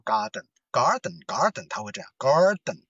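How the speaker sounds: "garden" slow, American, r curled and den fully sounded; American "garden", full den; American accent. Shown as a demonstration. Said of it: "美国英语它会把这个呃会加上去".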